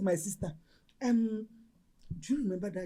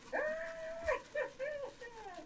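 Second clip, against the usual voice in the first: long, high squeal of delight; 7 dB quieter than usual. Acted. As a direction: squeal high over a gift. Delight over a gift.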